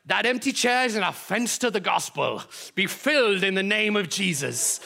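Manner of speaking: put-on fiery Welsh accent, preaching; booming Welsh preacher voice. Pastor imitating a Welsh preacher rebuking empty chairs, Welsh accent. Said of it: "I can't really do a Welsh accent, but I'm gonna try".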